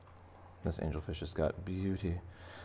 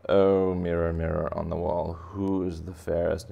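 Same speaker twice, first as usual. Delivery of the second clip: snooty angelfish; 5 dB louder than usual. Acted. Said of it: "this angelfish is a little snooty".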